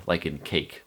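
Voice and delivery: normal talking voice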